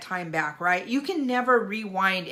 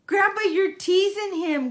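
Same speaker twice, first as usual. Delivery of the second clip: put-on much higher, louder little-girl voice; granddaughter's voice; 11 semitones higher, 5 dB louder than usual. Granddaughter scolding grandpa for teasing the dog, high and bright. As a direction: squeal it like a giggling child. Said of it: "she's like".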